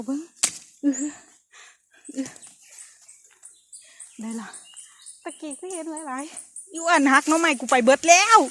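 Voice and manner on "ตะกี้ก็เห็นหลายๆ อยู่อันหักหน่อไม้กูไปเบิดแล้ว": excited. Real, not acted. Excited forager finds bamboo shoots.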